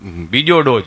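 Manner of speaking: low, dragged-out stammering answer; a stuttering simpleton slowly gets his name out; slow dim stammer. Retold or imitated. To the nurse, Gabho answers his name in a low stammer.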